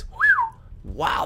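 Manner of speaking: usual speaking voice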